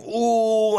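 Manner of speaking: high, drawn-out comic ooh; held high hoot; mock pained ooh. Imitates comedians getting hit.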